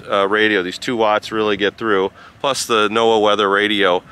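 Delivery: ordinary talk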